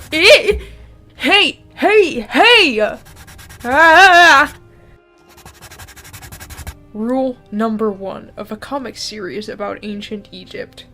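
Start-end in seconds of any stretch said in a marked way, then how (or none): none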